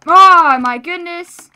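loud, high exclamation; astonished. Real, not acted. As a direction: burst out in amazement. After big fight.

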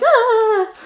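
high, loud playful "ah"; hyper squeal. Retold or imitated. Imitates self while bouncing hyper.

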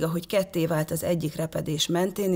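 plain reading voice

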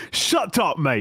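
high, mock British retort; hammed-up British accent. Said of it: "How's my British accent?".

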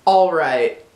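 "All right" said the American way, low-key; American pronunciation. Shown as a demonstration. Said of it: "first the way Americans say it".

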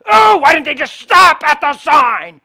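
high, drawn-out whiny complaint; mocking a grumbler at the crash. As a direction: whine it like a put-out bystander.

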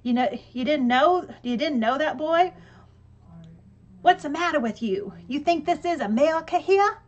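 loud, high mock Southern hillbilly accent; Southern style. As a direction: keep hamming the hillbilly voice for laughs. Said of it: "some more Southern style stuff".

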